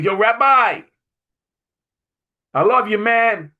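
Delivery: retold yell. Bouncer John yelling his love to the rabbi from the exit, loud. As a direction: yell it out like a big friendly bouncer. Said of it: "And he yells".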